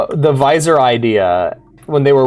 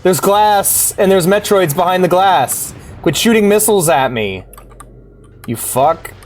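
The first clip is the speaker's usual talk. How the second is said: Samus yelling; 7 semitones higher, slower than usual. Retold or imitated. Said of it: "just like her going".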